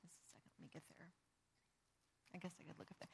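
muttered under breath while finding the page; hushed aside to herself, fast; whispered aside. Real, not acted. Pastor pauses the reading to find her place, whispering.

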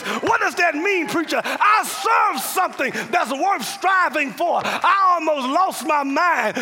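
loud, high, rising preacher's climax; preaching at full force. Real, not acted. Preacher to congregation, fiery shouted testimony.

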